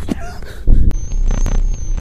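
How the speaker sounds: nervous scared laugh. Real, not acted. Panic laugh at sudden scare.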